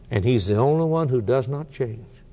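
ordinary talk, nothing marked

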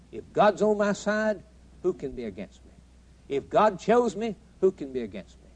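rolling revival cadence; preaching. Acted. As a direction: fling each question at the congregation. Preacher's escalating challenges.

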